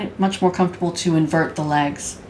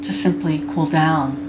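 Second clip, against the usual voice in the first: guided meditation. Acted. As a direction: guide them gently into body awareness, unhurried.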